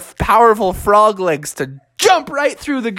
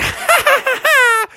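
very high staccato laugh; squeaky taunting giggle; gleeful baby cackle. Acted. Baby taunts chasing mom.

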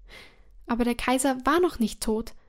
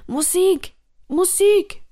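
much higher, loud frantic scream; 8 semitones higher, 7 dB louder, slower than usual. Acted. Terrified emperor screams for music, high and frantic. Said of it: "schrie der Kaiser".

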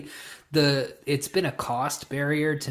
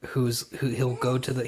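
ordinary talk

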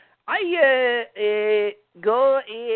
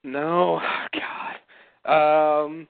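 ordinary talk, nothing marked

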